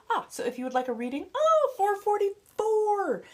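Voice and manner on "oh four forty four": much higher, louder, slow oh, four forty-four; delighted surprise. Real, not acted. Spotting four forty-four, a high loud surprised squeal. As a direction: gasp with joy at the number.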